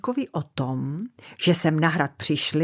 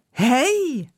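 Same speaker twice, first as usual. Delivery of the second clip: calling out; 8 semitones higher than usual. Acted. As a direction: call out to the flying mouse. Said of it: "zavolal na létající myš Kaštánek". (zavolání)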